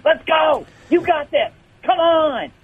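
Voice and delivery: high, strained hype-up yell; mock pep-talk scream. Imagining Jake screaming at the players before the game, mock pep-talk scream. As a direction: yell like rallying the team, high-pitched. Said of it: "screaming at the players".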